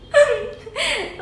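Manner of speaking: sudden high burst of laughter. Real, not acted. Laughing at the savage reply to parents. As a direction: laugh out at the cheeky answer.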